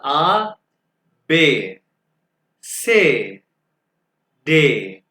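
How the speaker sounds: each letter called out singly, with long pauses; German alphabet demonstration. Shown as a demonstration. Teacher reads alphabet for students to follow, emphatic spaced-out letters. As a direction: model each letter name firmly, drawn out, one at a time. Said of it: "मैं आपके सामने दो दफा alphabets पढ़ूंगा".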